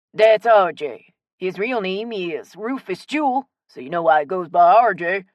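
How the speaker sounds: hearty Cajun man, words stretched; strong Cajun accent. A narrator's reading. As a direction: introduce yourself in broad Cajun. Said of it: "in his strong Cajun accent".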